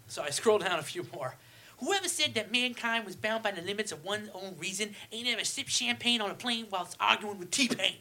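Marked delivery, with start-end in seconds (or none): 1.8-8.0 higher put-on [name] voice. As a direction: boast it like [name]. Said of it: "I'm gonna go with [name] voice".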